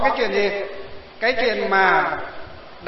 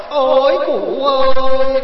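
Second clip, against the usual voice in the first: slow, drawn-out, louder, higher mock funeral lament; hired mourner's wail; 5 semitones higher, 9 dB louder, much slower than usual. Monk imitating a hired funeral mourner, drawn-out mock wailing. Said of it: "thuê chống kèn khóc là".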